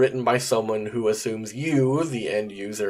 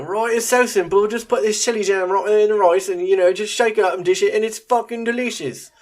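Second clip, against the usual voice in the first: high, loud, fast bad British accent; a chirpy Jamie Oliver British accent, hammed up; Jamie Oliver impression; 10 semitones higher, 4 dB louder than usual. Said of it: "apologies for my terrible accent".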